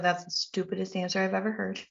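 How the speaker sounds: quick, soft sassy retort. Retold or imitated. Sassy woman to God, a quiet quick retort. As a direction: toss it back with a quiet eye-roll. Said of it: "Now I'm a little sassy".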